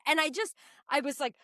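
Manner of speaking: usual speaking voice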